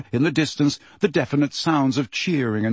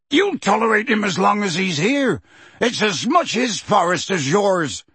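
yelling; 8 semitones higher than usual. Acted. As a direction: shout back at the centaurs. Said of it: "he yelled".

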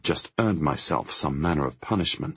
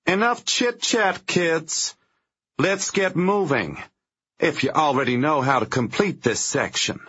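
sneering villain voice; 11 semitones higher, 4 dB louder than usual. Acted. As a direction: talk down to them like a cruel boss. Liz's sneering opening line.

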